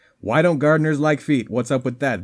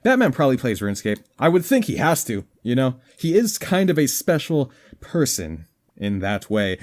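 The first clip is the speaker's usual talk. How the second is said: usual speaking voice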